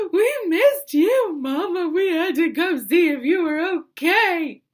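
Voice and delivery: loud, high, drawn-out bawl; hammed-up kids crying for Mama; wailing children. Children bursting in on mother, imitated loud wail. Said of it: "there's both of my children".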